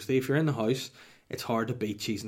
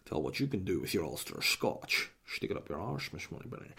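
low, slushy voice, sinking softer; spy-film impression; 3 semitones lower, 5 dB quieter than usual. Host playing spy to Miss Moneypenny, slushy impression. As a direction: slur every s into sh, dropping away to a murmur.

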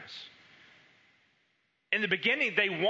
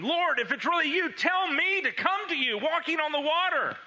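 calling out; 9 semitones higher than usual. Retold or imitated. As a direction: call to him over the waves. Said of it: "Peter called out to him".

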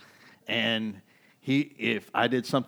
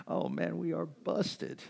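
higher, slow, sheepish "we are busted"; caught disciples; 7 semitones higher, 4 dB quieter, slower than usual. Retold or imitated. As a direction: play the guilty disciple, caught red-handed. Apostles caught by Jesus.

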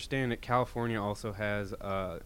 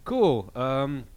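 sarcastic; 4 semitones higher, 5 dB louder than usual. Retold or imitated. Sarcastic retort to police.

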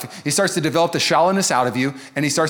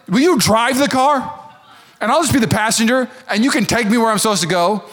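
pleading passenger; 6 semitones higher, 4 dB louder than usual. Retold or imitated. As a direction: hand over the wheel, begging. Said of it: "They'd be like".

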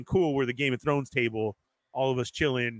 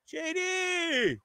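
calling out; 13 semitones higher than usual. Retold or imitated. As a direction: sing out the name across the bar. Calls across the bar.

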